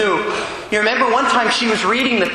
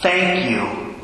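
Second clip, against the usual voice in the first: faint distant voice; 2 semitones lower than usual. Retold or imitated. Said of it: "she heard that sister's voice say".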